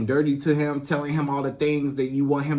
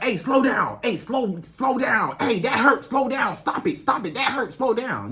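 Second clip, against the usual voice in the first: harsh complaint; 8 semitones higher than usual. Shown as a demonstration. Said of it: "You don't have to be like".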